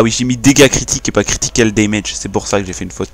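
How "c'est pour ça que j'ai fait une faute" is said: normal talking voice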